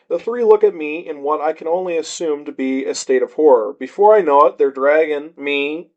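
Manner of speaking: usual speaking voice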